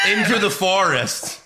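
ordinary talk

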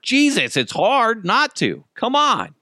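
pleading, helpless at the festival costumes; exasperated. Retold or imitated. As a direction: plead with the heavens. Exasperated at temptation.

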